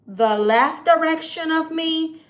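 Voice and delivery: ordinary talk, nothing marked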